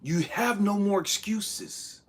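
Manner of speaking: ordinary talk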